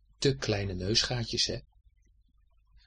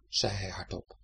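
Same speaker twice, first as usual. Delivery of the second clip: ordinary delivery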